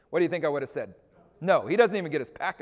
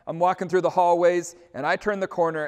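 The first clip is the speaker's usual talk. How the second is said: ordinary talk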